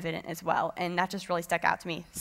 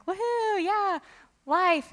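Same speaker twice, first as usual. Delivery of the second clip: very high, airy mock cheer; mock glee; 10 semitones higher, much slower than usual. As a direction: cheer as if life were a carefree breeze. Mocking expectation of an easy walk.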